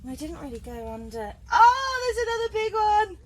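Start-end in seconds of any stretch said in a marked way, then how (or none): 1.5-3.2 excited. Real, not acted. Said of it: "I'm getting too excited".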